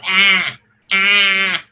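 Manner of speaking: ah as the broad A in cat; ash vowel sound. Shown as a demonstration. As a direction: open flat A, ah. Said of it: "It makes this sound".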